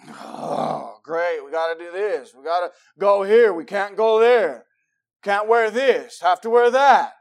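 groan, then complain through a list of rules; grudging, bummed. Acted. Preacher mimics believer resenting holy living, griping. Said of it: "a drag or a bummer".

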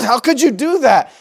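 lightly hammed-up scandalised question; mock-shocked critic. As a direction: act appalled at a parent's cruelty. Imitates listeners shocked at his parenting.

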